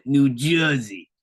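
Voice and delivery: put-on "New Jersey" said as "Noo Joizey" in a New Jersey accent; a light SNL-style New Jersey accent. Host repeats the SNL New Jersey accent. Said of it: "I got that from, I think, S N L".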